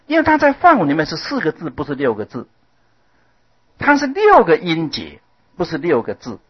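ordinary talk

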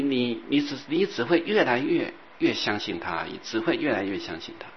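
normal talking voice

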